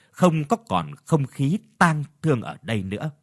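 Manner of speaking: usual speaking voice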